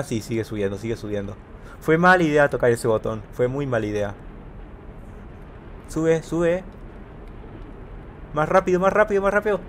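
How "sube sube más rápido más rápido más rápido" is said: urgent urging. Real, not acted. Urging character up fleeing water.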